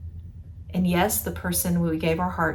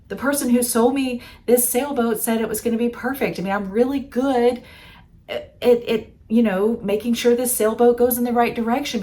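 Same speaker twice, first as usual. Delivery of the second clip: higher, louder flustered inner voice; higher, louder exasperated inner thoughts; frustrated sailor; 5 semitones higher, 5 dB louder than usual. Acted. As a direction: sound exasperated and scared at the storm.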